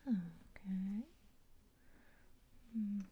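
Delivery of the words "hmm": ordinary talk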